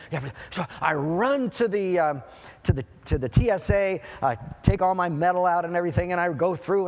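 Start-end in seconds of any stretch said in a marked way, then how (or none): none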